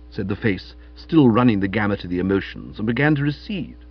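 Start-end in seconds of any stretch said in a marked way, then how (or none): none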